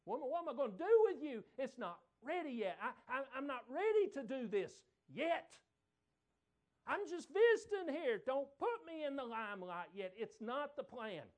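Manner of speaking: high, loud, fast pleading protest; protesting son. Retold or imitated. Son protesting loudly to his mother's request. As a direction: beg her not to push you yet. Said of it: "His response said".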